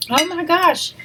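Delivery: ordinary talk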